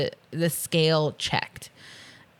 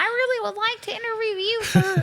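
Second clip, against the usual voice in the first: nerd voice. Retold or imitated. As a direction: play it like a timid nerd. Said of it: "Doing a nerd voice".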